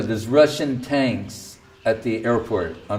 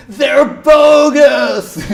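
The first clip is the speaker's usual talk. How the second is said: high, drawn-out shrieking yell; screaming; 11 dB louder, much slower than usual. Retold or imitated. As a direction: scream it out at the crowd. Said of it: "out in the street screaming".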